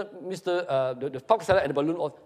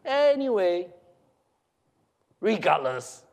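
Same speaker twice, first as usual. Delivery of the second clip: drawn-out mocking brush-off; snickering dismissal; 3 semitones higher, 4 dB louder, slower than usual. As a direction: start high, drop to dismissive. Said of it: "he snickers".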